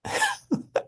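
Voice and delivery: sudden high burst of laughter; high squeal of laughter. Real, not acted. Woman laughing at her old grudges, high burst of laughter. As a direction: laugh high and short at yourself.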